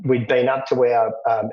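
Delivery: ordinary talk, nothing marked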